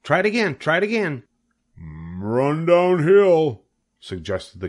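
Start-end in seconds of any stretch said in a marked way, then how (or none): 0.0-1.2 very high goose cry of praise; goose cheering. A narrator's reading. The goose praises Wilbur for dodging, crying out. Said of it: "cried the goose". 1.8-3.6 slow, loud cow voice; mooing cows. A narrator's reading. The cows suggest a way to Wilbur, mooing slowly. Cows advise the fleeing pig.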